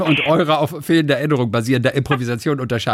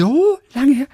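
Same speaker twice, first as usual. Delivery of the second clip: surprised; 13 semitones higher, 5 dB louder than usual. Real, not acted. Reacting to partner's remark.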